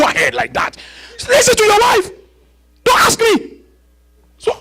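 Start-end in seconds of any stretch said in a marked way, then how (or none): 1.3-3.6 God's retort. Retold or imitated. God tells Abraham to just listen to his wife, high pitched. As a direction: snap back as God.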